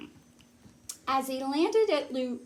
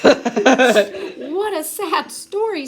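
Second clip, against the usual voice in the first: slow, laughing through the line; 5 semitones higher, 10 dB louder, much slower than usual. Acted. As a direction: laugh while lamenting slowly. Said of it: "beginning to sniffle".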